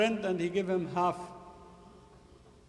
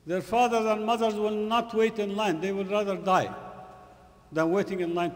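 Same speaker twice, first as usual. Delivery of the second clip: louder, higher sermon delivery; emphatic preaching; 5 semitones higher, 6 dB louder than usual. Real, not acted. Preacher defending the parents' dignity to the congregation, emphatic preaching. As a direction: press each word home forcefully.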